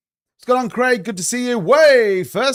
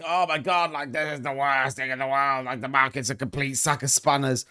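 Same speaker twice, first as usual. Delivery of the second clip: mock panicking trader; 4 semitones lower, 7 dB quieter than usual. People panicking over market on X.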